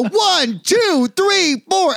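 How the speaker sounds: mock studio count-in, climbing each number. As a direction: build the count like a record intro. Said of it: "when they go".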